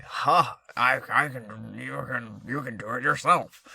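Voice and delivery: a hint of a smug troll sneering; edgelord voice. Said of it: "That's my edgelord voice".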